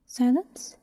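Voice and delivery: ordinary delivery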